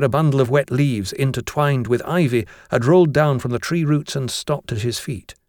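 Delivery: usual speaking voice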